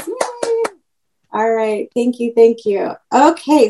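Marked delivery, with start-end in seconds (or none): 0.1-0.6 cheer. Real, not acted. Cheering after applause.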